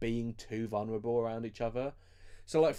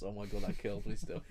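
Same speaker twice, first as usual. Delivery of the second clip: ordinary delivery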